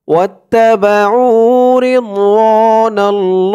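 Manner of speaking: slow tajweed recitation; recite along. Shown as a demonstration. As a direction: model it slowly for the class to repeat. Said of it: "আমার সাথে বলুন".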